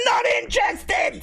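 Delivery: high, drawn-out yell; dismissive shout. Real, not acted. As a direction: shout the interruption down, stretching the words. Shouts down the assistant still talking.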